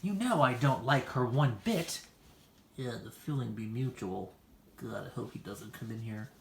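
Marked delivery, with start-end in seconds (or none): 2.8-6.3 muttered aside. Acted. Muttered aside during greeting.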